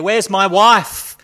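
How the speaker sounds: drawn-out acted scream. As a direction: scream it into the void. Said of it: "he screamed".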